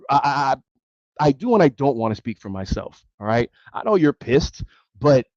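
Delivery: usual speaking voice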